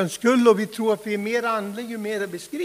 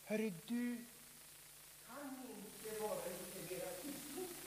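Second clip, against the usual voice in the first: much softer, almost hushed asking; hushed request; 21 dB quieter than usual. Acted. Critics asking Levi Petrus to tone down, hushed. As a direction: ask meekly under your breath. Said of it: "Och de frågar Levi Petrus".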